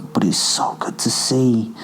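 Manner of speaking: unvoiced, gloating whisper. A possessive spirit gloating inside the narrator's mind, whispered. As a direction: breathe it like a voice in the head. Posit speaking into his mind.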